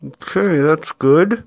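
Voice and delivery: phone held in mouth, words muffled; talking through clenched teeth. Real, not acted. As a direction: speak around an object between teeth. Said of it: "I'm holding the phone with my mouth".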